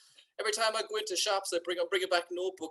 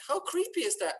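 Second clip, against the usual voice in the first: higher, pitched-up disbelief; reacting to Google's tracking email, creeped out; 10 semitones higher than usual. Higher, creeped-out disbelief. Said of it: "And I was like".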